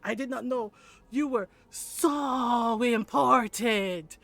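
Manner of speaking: slow, drawn-out mock deference; sarcastic awe. Aunt putting the doctor in his place, sarcastic awe. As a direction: stretch the words in feigned reverence.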